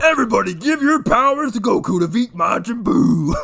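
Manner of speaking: high, loud hammed-up rallying cry; a cartoon champion rallies everyone; Mister Satan. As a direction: shout like a cartoon showman. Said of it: "Mister Satan at the end of Dragon Ball Z".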